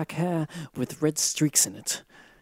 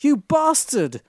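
angry shout; 11 semitones higher, 4 dB louder than usual. A narrator's reading. Goth girl shouts at Draco after spotting the tattoo, furious. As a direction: shout it at the lying lover. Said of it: "I shouted angrily".

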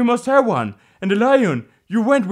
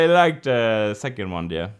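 ordinary delivery

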